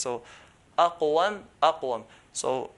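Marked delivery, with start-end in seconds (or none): 0.8-2.0 "aqwam" with qaf stopped on sukun, moderately heavy; third strongest. Shown as a demonstration. Teacher showing qaf with sukun, moderately heavy "aqwam". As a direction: close firmly on the "q" in "aqwam". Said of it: "third strongest".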